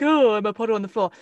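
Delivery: loud, quick mock wail; mock wet drip. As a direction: play a hopeless, spineless auditioner. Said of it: "a wet drip, like, just being like".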